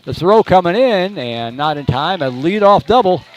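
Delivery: fast, high, loud hit call; excited play-by-play. Acted.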